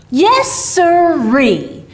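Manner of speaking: drawn-out hearty Santa yes. A narrator's reading. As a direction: stretch it out like a booming jolly Santa. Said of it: "returned Santa promptly".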